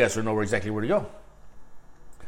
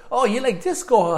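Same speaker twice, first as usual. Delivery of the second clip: high, playful teasing; a high, playful jab about his disco; teasing question; 10 semitones higher, 4 dB louder than usual. Retold or imitated. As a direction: needle him playfully.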